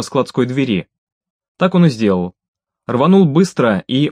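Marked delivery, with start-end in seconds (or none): none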